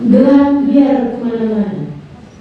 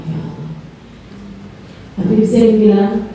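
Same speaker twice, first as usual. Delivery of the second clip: usual speaking voice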